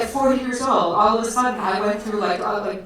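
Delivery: ordinary talk, nothing marked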